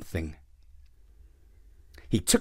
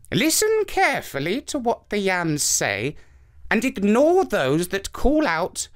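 put-on higher old woman giving instructions; old woman's voice; 8 semitones higher than usual.

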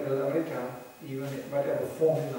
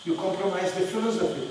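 normal talking voice

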